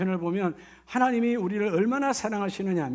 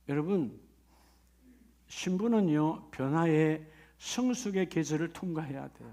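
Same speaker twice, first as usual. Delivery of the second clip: normal talking voice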